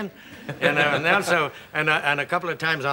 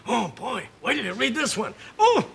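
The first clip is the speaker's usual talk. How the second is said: much higher, eager retold excitement; excited quote; 10 semitones higher than usual. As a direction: gush like an actor who loves the script. Retells castmates excited over scripts.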